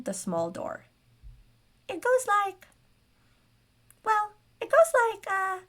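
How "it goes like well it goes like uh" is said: very high squeaky gnome, huffing. A narrator's reading. Gnome tugging at a stuck door, huffy high squeak. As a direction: squeak with effort, as a small gnome. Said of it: "he huffed".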